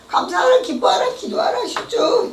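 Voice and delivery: high, rising 감사하라 기뻐하라 기도하라, each line lifting; high pleading. Retold or imitated. Reminding God of his own words, high and pleading.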